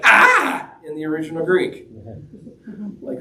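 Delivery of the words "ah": sudden high hammed-up cry of fright; mock terrified scream. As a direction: yelp like scared fishermen seeing a ghost. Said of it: "I think that's something like".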